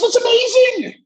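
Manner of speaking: a hammed-up high-voiced professor; high teacher impression. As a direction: play the cheery teacher, squeaky high. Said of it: "He goes".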